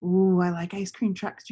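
plain reading voice

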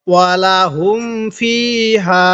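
slow recitation with "ফিহা" drawn long; three-alif stretch. Shown as a demonstration. Teacher applying madd, slow reading with long-held "ফিহা". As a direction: hold the last "aa" of "ফিহা" at length. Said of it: "তিন আলিফ টান দিব".

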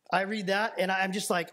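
ordinary delivery

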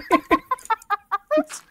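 loud laugh. Real, not acted. Friend laughing at a joke about a fallen king, loud burst of laughter.

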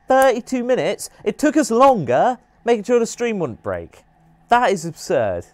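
high, loud disbelief; incredulous. Real, not acted. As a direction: react to an absurd number. Outraged at speedrun time.

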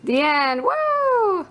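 sudden high whoop; excited cheer. Real, not acted. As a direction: cheer the ending. Cheering story's end.

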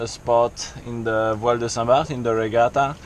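usual speaking voice

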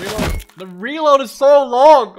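loud, high, slow wail at the reload; frustrated yell. Real, not acted. Gamer stuck reloading under fire, yelling in frustration. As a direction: drag it out in exasperation.

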